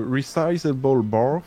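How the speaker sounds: Resizable Bar said slowly in a hammed-up English accent; self-mocking English accent on Resizable Bar. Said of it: "avec mon accent anglais, super perfectionné".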